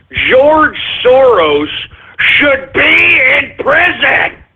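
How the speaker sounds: slow, deliberate, every word weighed; slow emphatic verdict. Real, not acted. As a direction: drag it out word by word.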